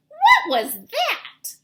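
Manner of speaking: put-on high, loud, slow alarmed baby bird; startled cry. A narrator's reading. Baby bird startled by a bat in the nest, crying out. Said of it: "cried Flap".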